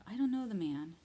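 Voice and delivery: loud, emphatic denial; denial with an oath. A narrator's reading. Peter denying Jesus to the servant girl, loud sworn denial. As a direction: swear it loudly. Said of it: "He denied it again with an oath".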